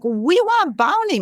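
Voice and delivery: put-on small child who can't say R, high and slow; child's lisping speech. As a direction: mimic a little girl saying Bonnie for Barney. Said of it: "My daughter at a speech impediment, couldn't say her R's".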